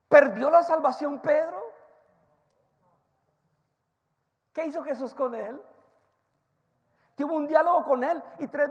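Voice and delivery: loud, fast, high sermon delivery; full-force preaching. Acted. Preacher retelling Peter's story to the congregation, loud and fast. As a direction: drive the story home at the top of your voice.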